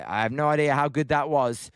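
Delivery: ordinary talk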